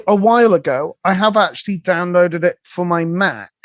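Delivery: ordinary talk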